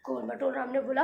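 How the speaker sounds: ordinary delivery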